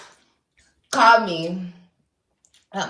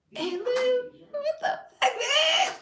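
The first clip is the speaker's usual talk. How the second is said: much higher, squealing fan voice; excited fan girls; slower than usual. Retold or imitated. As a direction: squeal like star-struck inmates. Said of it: "I had bitches coming up to me".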